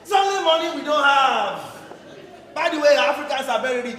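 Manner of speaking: put-on ranting boyfriend; loud rant. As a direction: hammer the point home, loudly. Boyfriend ranting about Nigeria.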